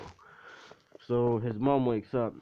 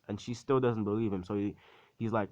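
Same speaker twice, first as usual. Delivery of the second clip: usual speaking voice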